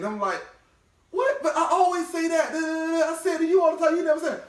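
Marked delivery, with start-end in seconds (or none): 1.1-4.4 high, incredulous protest; retelling his stunned defence to his girlfriend; indignant disbelief. Retold or imitated. Man retelling a spat with his girlfriend, high incredulous protest.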